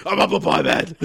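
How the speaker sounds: loud, high superhero catchphrase; hammed-up hero cry; mock superhero. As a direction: announce yourself like a comic hero. Acts out pie-throwing superhero.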